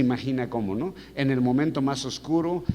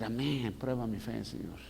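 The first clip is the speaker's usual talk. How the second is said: soft, fading, reluctant amen; meek prayer; 9 dB quieter than usual. Acted. Preacher modelling the congregation's reply, meek and quiet. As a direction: trail down to a very quiet plea.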